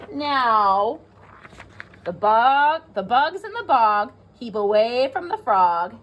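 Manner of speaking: put-on lively read-aloud for young children; teacher reading a rhyming book to the class; storybook reading. A narrator's reading.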